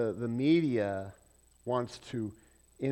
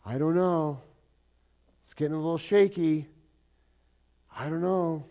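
mock nervous investor; 4 semitones higher, 8 dB louder than usual. Investors panicking, imitated.

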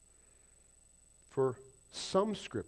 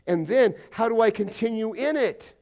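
loud, high mock questioner; voiced believer asking; 9 semitones higher, 11 dB louder than usual. Real, not acted. Pastor voicing a believer asking God, loud and pleading. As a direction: play the confused believer asking what now. Preaching question at full force.

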